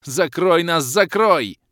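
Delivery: loud high urgent plea; urgent shout. Acted. Hero begging the tank to cover them, urgent shout. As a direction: yell for cover.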